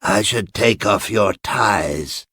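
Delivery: put-on slow, measured instruction from an older woman; suggesting elderly woman. A narrator's reading. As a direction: suggest it slowly, in character. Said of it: "suggested the elderly woman".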